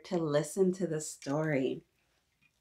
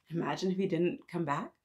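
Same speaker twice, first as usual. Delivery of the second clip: usual speaking voice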